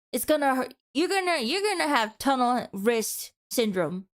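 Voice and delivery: usual speaking voice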